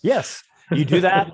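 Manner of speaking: loud, high burst of approval; excited approval. Real, not acted. Game master approving the player's fireball, excited. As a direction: cheer the player's move on.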